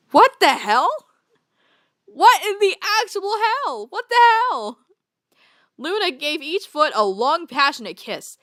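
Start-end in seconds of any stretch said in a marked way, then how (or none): 0.1-4.8 much higher, stunned outcry; shocked disbelief. Real, not acted. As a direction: react in pure shock. Shocked reaction to fanfic.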